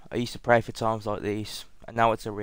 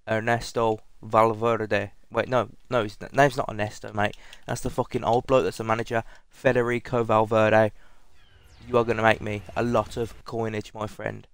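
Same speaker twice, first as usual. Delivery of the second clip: ordinary talk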